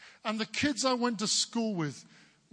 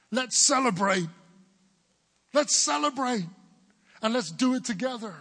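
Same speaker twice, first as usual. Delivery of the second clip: loud, raised rallying cry; celebratory call; 5 semitones higher, 6 dB louder than usual. Acted. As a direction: call everyone to the party. Acts out the father calling a party.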